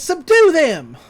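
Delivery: high, loud villain's order; hammed-up commanding yell; mock command. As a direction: shout the order to your minions. Acts Gideon ordering his gnomes.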